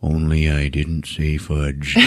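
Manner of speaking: slow, low mock voice-over; inner narrator. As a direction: imitate a wistful film narration. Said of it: "in his mind go".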